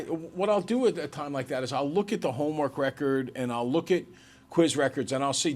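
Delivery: ordinary talk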